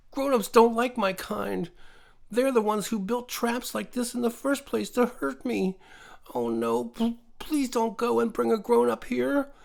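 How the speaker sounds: high, pleading creature voice; high-pitched scared woodland creature; frightened creature. A narrator's reading. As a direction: beg her not to fetch an adult. Teddy pleads, scared of grown-ups.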